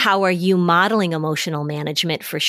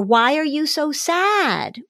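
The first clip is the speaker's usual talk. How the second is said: higher and slow, questioning the sadness; puzzled dismissive parent; 8 semitones higher, slower than usual. Shown as a demonstration. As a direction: ask it slow and high, as if sadness makes no sense.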